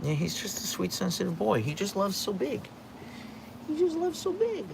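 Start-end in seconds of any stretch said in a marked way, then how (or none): 0.4-2.7 sweet dog voice. Acted. Baby talk to dog. 3.7-4.6 put-on much higher, soft squeaky baby voice for the dog; squeaky baby talk. Cooing to the sweet husky in squeaky baby talk.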